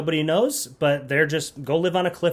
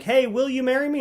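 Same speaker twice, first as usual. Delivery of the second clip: higher-pitched mock proposal; eager suitor; 9 semitones higher than usual. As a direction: imitate a hopeful suitor popping the question. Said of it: "every single time he's like".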